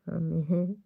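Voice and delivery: normal talking voice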